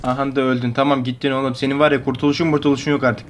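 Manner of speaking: ordinary talk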